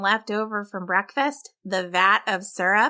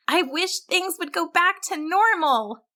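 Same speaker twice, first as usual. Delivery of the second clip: higher, hopeful wish to the sky; 8 semitones higher than usual. A narrator's reading. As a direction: make a heartfelt wish on a star. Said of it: "and wished".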